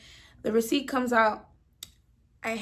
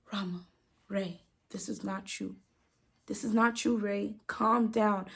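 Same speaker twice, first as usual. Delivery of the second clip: much softer, hushed imitation of a manager; low calming plea; 3 semitones lower, 7 dB quieter than usual. Manager talking down an upset cashier, hushed movie-style plea. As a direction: talk someone down under your breath, movie-style. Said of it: "she was doing a low".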